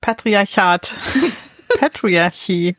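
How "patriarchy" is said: PATRIARCHY attempted slowly in English; tentative English pronunciation. Real, not acted. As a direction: sound out PATRIARCHY, unsure of the English. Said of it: "wie wird denn das auf Englisch ausgesprochen". (PATRIARCHY zögernd auf Englisch ausgesprochen)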